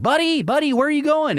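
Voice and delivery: high coaxing call to a bolting cat; calling after cat. Retold or imitated. Owner calling to scared cat, high coaxing call.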